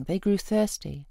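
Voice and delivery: ordinary delivery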